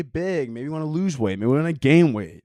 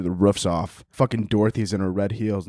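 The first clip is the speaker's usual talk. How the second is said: ordinary talk, nothing marked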